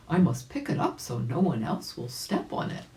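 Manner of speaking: ordinary delivery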